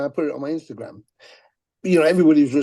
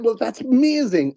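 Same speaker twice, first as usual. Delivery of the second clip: higher, cheerful turnaround; disarming brightness; 8 semitones higher than usual. Retold or imitated. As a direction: answer the insult with sunny cheer. Responding to troll kindly.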